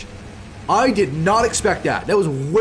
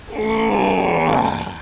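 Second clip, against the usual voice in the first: frustrated groan; 3 semitones higher than usual. Real, not acted. Game lost.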